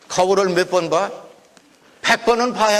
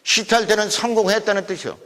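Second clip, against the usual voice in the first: ordinary delivery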